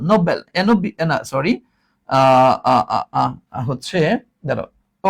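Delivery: ordinary talk, nothing marked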